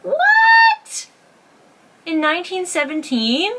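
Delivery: squealed, very high, loud disbelief; shocked. Real, not acted. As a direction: shriek the question in real astonishment. Reacting to a surprising article.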